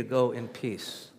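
slow, soft "Go in peace"; a touch of tender release; gentle blessing. Acted. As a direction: let it land tenderly. Jesus dismisses the woman.

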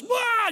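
high falling yell as if tumbling; mock falling yell. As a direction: yelp like you've been shoved off a cliff. Pushed off a cliff.

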